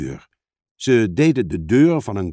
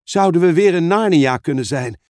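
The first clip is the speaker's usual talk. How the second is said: higher, eager question; exclaiming; 5 semitones higher, 4 dB louder than usual. Acted. Lucy wondering if they are back in Narnia, exclaiming. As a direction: ask it excitedly. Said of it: "riep Lucy uit".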